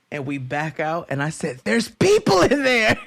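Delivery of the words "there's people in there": high, louder alarmed report; startled, pitched up and loud; alarmed cry. Retold or imitated. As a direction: blurt it out in shock. Alarmed discovery retold.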